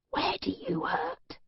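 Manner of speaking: hushed, muffled whisper. Acted. Captor ordering the captive to drink, muffled whisper. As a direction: whisper it through a mask. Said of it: "muffled whisper".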